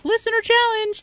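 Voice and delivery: Listener challenge sung out high; high sing-song call. Acted. Announcing segment jingle.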